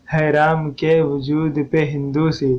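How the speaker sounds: slow, syllable-by-syllable verse recitation; measured scansion. Shown as a demonstration. Teacher showing students the verse's metre, slow measured scansion. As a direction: draw out each metrical unit evenly.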